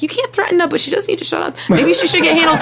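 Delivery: high, conflicted mock voice; host quoting herself caught between sides, high; flustered mock self. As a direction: play yourself torn and flustered. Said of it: "I'm like".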